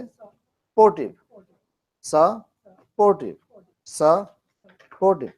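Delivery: Supportive spoken very slowly, sa first, then por-ti; syllable by syllable. Shown as a demonstration. Teacher drills student on Supportive slowly, sa first. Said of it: "सा बोलो पहले".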